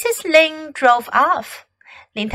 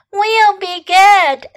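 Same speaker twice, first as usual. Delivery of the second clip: put-on high, drawn-out children's voice; kids promising; 7 semitones higher, 5 dB louder, slower than usual. A narrator's reading. Scolded Ling kids answer Fox, childlike promise. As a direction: promise like obedient little children.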